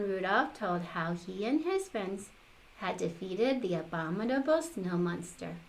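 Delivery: usual speaking voice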